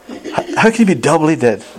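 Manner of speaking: high-pitched, baffled question; incredulous question. Acted. Preacher challenging congregation on doubly dead, incredulous question. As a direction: puzzle over it aloud, voice shooting up. Preacher voices puzzlement at the verse.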